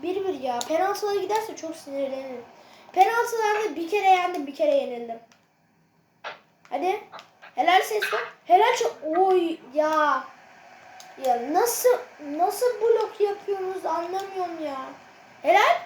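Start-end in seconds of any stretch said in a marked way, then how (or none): none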